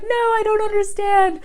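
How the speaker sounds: high, lost and baffled; confused tourist. Retold or imitated. Tourist abroad baffled by metric units, high and lost. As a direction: squeak it out like a confused traveler. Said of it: "we're like".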